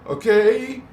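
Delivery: high, loud, laughing; high and loud, laughing at the roller coaster; excited. Real, not acted. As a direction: burst out excited about the ride. Excited about the ride.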